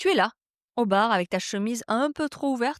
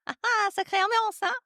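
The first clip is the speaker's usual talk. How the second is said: nervous mock laughter, much higher; forced loud laugh; 12 semitones higher than usual. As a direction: fake confident laugh, pitched way up. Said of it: "essayes de rire fort pour montrer que tu es confiant".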